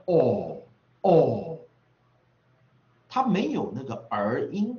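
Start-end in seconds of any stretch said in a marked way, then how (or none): 0.1-1.6 loud, long 哦 sliding downward; or vowel demo. Shown as a demonstration. Teacher showing students the reading of or, a drawn-out 哦. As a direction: sound out 哦 firmly as a held vowel. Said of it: "他念的哦".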